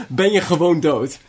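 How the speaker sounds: normal talking voice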